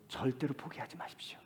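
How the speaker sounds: whispered plea, 절대로 leaned on; soft, unvoiced urging; earnest whisper. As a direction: whisper it close to each listener. Intimate urging to congregation.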